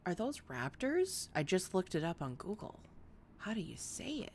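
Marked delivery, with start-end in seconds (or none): 3.4-4.3 whispered to herself; soft unvoiced whisper. Real, not acted. Reading a dino name off Google.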